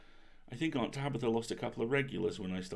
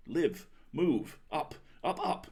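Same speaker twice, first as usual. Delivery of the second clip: commanding spell; 5 semitones higher, 4 dB louder, slower than usual. A narrator's reading. Narrator casting a spell on cookie.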